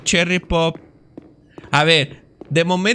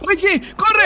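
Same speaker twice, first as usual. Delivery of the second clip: panicked shout; 12 semitones higher than usual. Real, not acted. Fleeing a monster in game.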